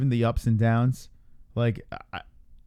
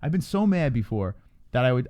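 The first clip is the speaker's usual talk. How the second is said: ordinary talk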